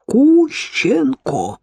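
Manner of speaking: «Кущенко!» drawn out slowly, syllable by syllable, high. A narrator's reading. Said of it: "повторил он по слогам".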